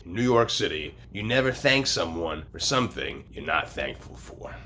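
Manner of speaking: ordinary talk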